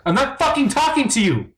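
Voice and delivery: high, heated tirade; angry actor shouting at the monster; furious rant. Acted. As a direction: yell him down, outraged.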